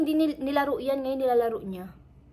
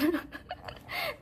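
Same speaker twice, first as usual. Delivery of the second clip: high laugh; 7 dB quieter than usual. Real, not acted. Laughs after joke.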